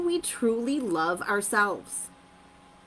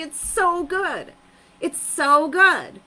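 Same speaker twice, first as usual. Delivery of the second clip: excited emphasis; 6 semitones higher, 5 dB louder, slower than usual. Real, not acted. Coach building excitement.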